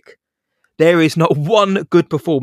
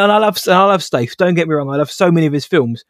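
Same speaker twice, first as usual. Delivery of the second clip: ordinary delivery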